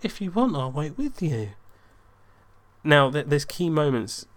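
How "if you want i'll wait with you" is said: high voice easing down at the end; imitating the date. Date offering to wait with him, imitated high voice. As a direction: mimic her offer in a raised pitch. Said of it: "she turns to me and she goes".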